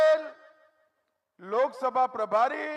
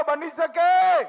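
બની શકે asked again much louder and slower, falling on શકે; louder, slower call; 5 semitones higher, 7 dB louder, slower than usual. Shown as a demonstration. Politician pushing the rally crowd to shout back, loud slow call. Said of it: "અરે જોરથી બોલો".

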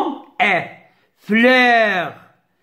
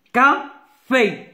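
"Café" in two separate beats; split into syllables; 3 semitones higher than usual. Shown as a demonstration. Teacher cutting words up for pupils, syllable by syllable. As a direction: break "Café" after "Ca", pause, then "fé". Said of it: "je découpe les mots".

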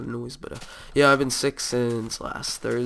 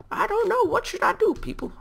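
squeaky-high mock helplessness, louder; playful falsetto; 4 dB louder than usual. Gamer jokingly asking viewers for advice, playful falsetto. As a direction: throw it up high into a comic falsetto.

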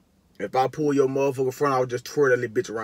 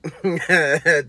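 burst of laughter; 4 semitones higher, 5 dB louder than usual. Real, not acted. Laughing at the lyric.

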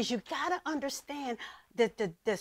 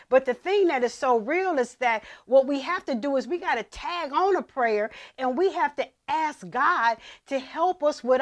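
building preaching; 8 dB louder than usual. Acted. Preaching builds to full force.

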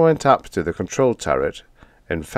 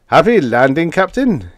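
chortling; 9 semitones higher, 6 dB louder than usual. A narrator's reading. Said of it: "he chortled".

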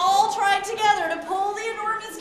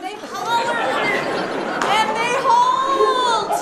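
loud; 4 semitones higher, 5 dB louder, much slower than usual. A narrator's reading. Narrator acts the hauling.

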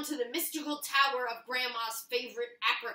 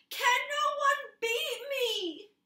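much higher, slow sorrowful cry; mock lament; 13 semitones higher, 7 dB louder, much slower than usual. Paper lamenting that no one can beat him, slow mock sorrow. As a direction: wail at the sky for a rival.

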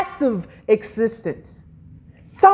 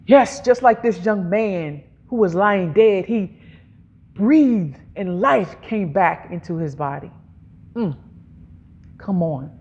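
normal talking voice

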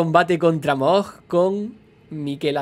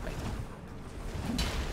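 tense whisper; 14 dB quieter, much slower than usual. Real, not acted. Tense moment in boss fight.

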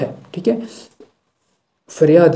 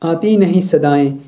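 slow, drawn-out recited line; poem recitation; 3 semitones higher, 3 dB louder, slower than usual. Acted. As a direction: recite the line slowly, lingering on नहीं. Recited verse vs explanation.

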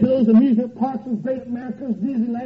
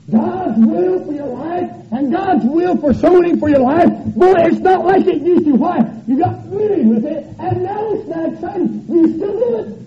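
loud, raised, urgent preacher's cry; preaching at full force; 5 semitones higher, 5 dB louder than usual. Real, not acted. Preacher scolding church about lost excitement, preaching at full force. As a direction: drive it hard and high from the pulpit.

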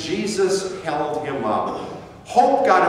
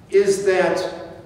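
ordinary delivery

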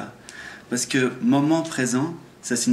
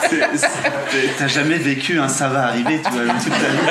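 louder, talking through laughter; laughing; 5 dB louder than usual. Real, not acted. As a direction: squeeze the words out while laughing.

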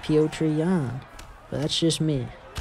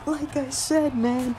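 ecstatic disbelief; 9 semitones higher than usual. Real, not acted. Gamer celebrating an early leg-kick knockout, ecstatic. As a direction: shriek with delight at the knockout.

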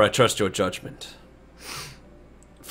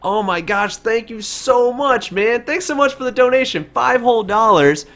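much higher, slow gushing thank-you; 10 semitones higher, 4 dB louder than usual. Real, not acted. Streamer gushing thanks to a donor, high and drawn out. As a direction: gush over the donation.

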